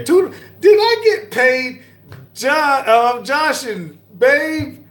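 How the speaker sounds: very high, loud surprise, slowed on the names; surprised squeal. Real, not acted.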